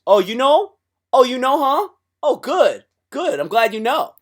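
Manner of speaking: high, loud stoned voice holding in smoke; stoned guy voice. Acted. Stoner holding smoke in, talking to his buddy high and loud. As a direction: talk while holding in a hit.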